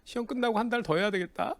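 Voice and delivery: high-pitched mocking imitation of a friend; teasing, snide tone pitched well up; sneering sarcasm. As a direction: needle them with snide false concern. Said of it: "비아냥대는 말투 있잖아요".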